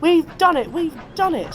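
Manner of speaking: high-pitched delighted cheer; jubilant Percy. A narrator's reading. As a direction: celebrate in a high little engine voice. Percy celebrates seeing the town.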